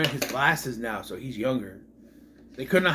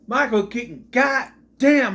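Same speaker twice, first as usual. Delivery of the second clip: higher, louder burst of frustration; exasperated outburst; 7 semitones higher, 6 dB louder than usual. Real, not acted. Reviewer lamenting a waste of Michael Keaton, exasperated outburst. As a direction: punch it out in exasperation.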